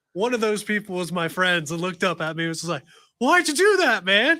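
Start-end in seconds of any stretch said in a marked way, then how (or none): 3.2-4.4 high, loud indignant "why'd you do that"; shocked protest. Retold or imitated. Knocked-over friend protesting at him, loud and high. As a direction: replay the friend's loud startled complaint.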